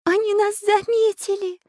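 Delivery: high-pitched cry of horror; terrified cry. A narrator's reading. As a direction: cry out in horror as a little heroine. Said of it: "в ужасе закричала Настенька". (испуганный крик тонким голосом)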